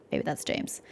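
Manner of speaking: quick unvoiced whisper to the table; a hushed side comment; whispered aside. Real, not acted. Muttering a guess to the other players, whispered.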